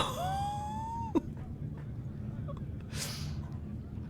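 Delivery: high airless laughter; long silent wheeze laugh. Real, not acted. Long laugh after the accent joke.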